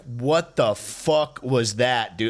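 ordinary talk

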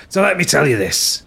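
lightly voiced Cockney geezer laying it down; Billy Butcher voice. Pirate captain telling the party his tale, in a lightly voiced Cockney. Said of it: "going back into the Billy Butcher voice".

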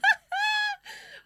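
goose honk. Pretend. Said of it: "do my goose impression".

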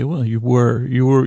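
ordinary delivery

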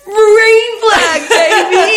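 high, loud squealed whoop; over-the-top celebratory yell; mock cheer. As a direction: shout it like a gleeful cheer. Mock cheering for arrest answer.

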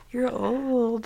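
lightly voiced ghostly warning; a hint of a haunting ghost; ghost from the future. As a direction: play a spooky future self, lightly. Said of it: "My ghost from the future".

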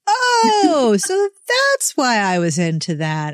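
high "Oh" of sudden realization, then musing; mock realization. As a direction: act out an aha moment, playful. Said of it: "you're like".

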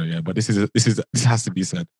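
usual speaking voice

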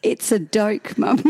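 "doke" with a D where J belongs; toddler's doke. Retold or imitated. Mum copies her son's childish word for joke. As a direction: say "It's a doke" like a small child. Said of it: "A D O K E".

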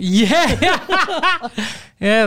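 high, laughing yeah; real laugh breaking through the word; laughing agreement. Real, not acted. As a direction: burst out laughing as you agree. Amused by co-host's summary.

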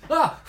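sharp high startled yelp; startled scream. Real, not acted. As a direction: jump at the scare. Startled by sudden scare.

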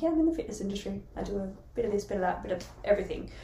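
quick throwaway intro line; rattled-off stock answer. Retold or imitated. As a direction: breeze through a rehearsed answer quickly. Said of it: "I'm like".

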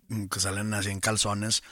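ordinary talk, nothing marked

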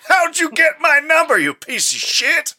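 high, loud squawking fury; angry exec voice. Pretend. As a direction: shriek it like an outraged boss. Mock exec on the phone.

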